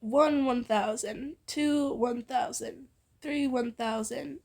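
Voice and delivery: slow, even count. Acted. Izuku counting the hug seconds, slow count. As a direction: count each second out deliberately.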